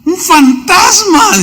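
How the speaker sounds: high, slow, fearful wail of a ghost sighting; frightened cry. Retold or imitated. Sailor sees a ghost on the waves, high frightened cry. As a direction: cry out as a scared sailor in a storm. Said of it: "dieron voces de miedo".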